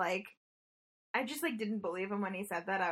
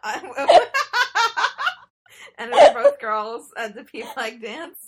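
laughing; 7 semitones higher, 17 dB louder than usual. Real, not acted. Laughing through the story.

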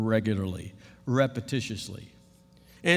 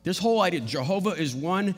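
ordinary talk, nothing marked

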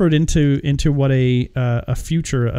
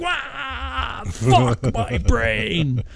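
high wail sliding into slow, drawn-out words; mock mind-blown wail; 9 semitones higher, 4 dB quieter, much slower than usual. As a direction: wail as if your brain is bursting. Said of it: "and they'll be like".

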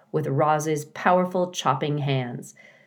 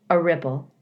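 ordinary delivery, nothing marked

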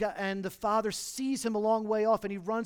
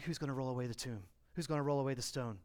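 hushed worried question; 6 semitones lower, 7 dB quieter, faster than usual. Acted. Women worried at tomb.

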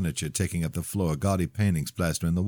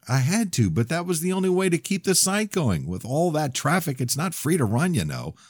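higher defensive character voice; defensive friend; 11 semitones higher than usual. Acted. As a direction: justify himself, a bit whiny. Voicing Jeremy in argument.

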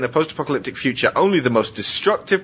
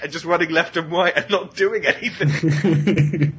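ordinary talk

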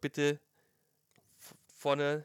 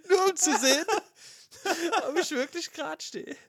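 high, laughing at herself; 13 semitones higher, 6 dB louder than usual. Real, not acted. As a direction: squeal it through laughter.

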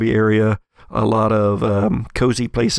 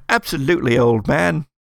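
a light, hammed-up fake British accent; 3 semitones higher than usual. Shown as a demonstration. GM repeats the NPC's farewell in mock British accent. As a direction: toff saying old chap, mildly put on. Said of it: "a kind of a fake British accent".

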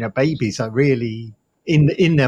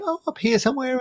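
much higher, showing where it supposedly sits; high female voice; 11 semitones higher, 3 dB quieter than usual. Retold or imitated. Man showing the expected female pitch, voiced much higher. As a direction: lift the voice way up to demo. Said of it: "a female voice has to be".

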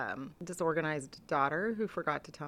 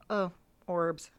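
unimpressed. Retold or imitated. Acting out unimpressed reaction to ghost videos.

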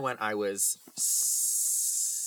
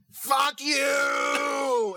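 much higher, loud street yell; yelled curse; 9 dB louder than usual. Retold or imitated. Cab driver yelling at old woman, loud curse. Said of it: "all I hear is".

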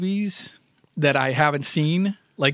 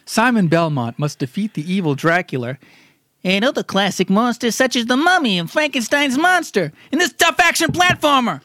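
put-on storytelling to kids; 6 semitones higher than usual. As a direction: read it like a bedtime tale for kids. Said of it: "gather around, kids".

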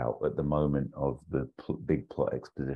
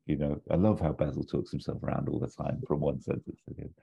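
ordinary delivery

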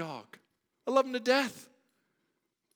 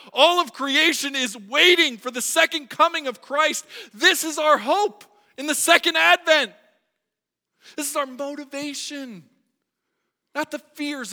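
building from loud to louder and higher; preaching at full force; 10 semitones higher, 15 dB louder than usual. Acted. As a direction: climb with each repeat.

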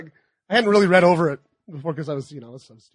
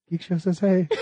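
softer, laughing imitation of a greeting; retold distant greeting; 5 dB quieter than usual. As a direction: imitate a listener's greeting heard from behind, softly. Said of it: "I just hear".

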